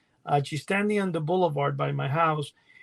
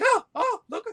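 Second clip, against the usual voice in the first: high, louder mock yell of surprise; retold excited scream; 5 dB louder than usual. Son and friend spotting a pterodactyl from the car, high mock yell. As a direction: imitate them shrieking at something out the car window. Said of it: "I hear them screaming".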